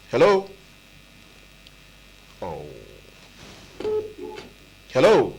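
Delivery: calling out. Real, not acted. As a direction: call out to check the line. Calling out to a silent caller.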